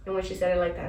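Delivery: normal talking voice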